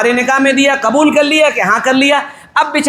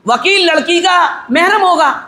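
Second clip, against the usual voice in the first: ordinary talk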